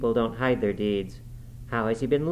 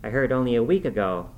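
ordinary delivery, nothing marked

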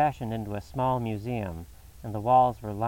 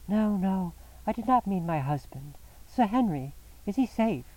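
much higher, anxious female voice; frightened wife asking after him; anxious woman's voice; 9 semitones higher than usual. A narrator's reading. Mrs Stapleton, freed, anxiously asks about Sir Henry, much higher.

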